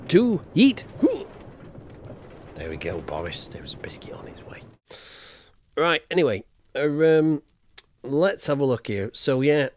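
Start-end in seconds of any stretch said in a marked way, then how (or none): 2.6-4.6 breathy whisper to the dog. Real, not acted. Streamer whispering to his dog Boris, soft whisper. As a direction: hush it out softly to Boris.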